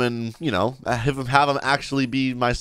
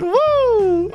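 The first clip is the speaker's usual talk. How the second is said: very high-pitched playful whoop; squealed mock-child cheer; excited kid cheer; 4 dB louder than usual. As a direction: whoop like a thrilled little kid.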